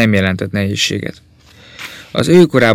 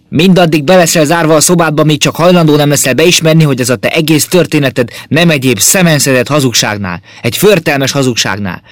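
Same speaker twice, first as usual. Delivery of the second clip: much higher and louder furious father; angry shout; 6 semitones higher, 5 dB louder than usual. A narrator's reading. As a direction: bellow at the son in rage. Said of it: "kiáltotta haragosan". (dühös, hangos kiabálás)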